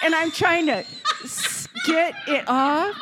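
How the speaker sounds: high, laughing, half-shouted; cracking up while telling the messy diaper story. Real, not acted. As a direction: laugh through the struggle. Laughing retelling of the mess.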